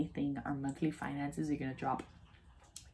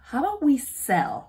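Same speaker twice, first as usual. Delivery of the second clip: eager; 8 semitones higher, 10 dB louder than usual. Retold or imitated. Husband's proposal, high and loud.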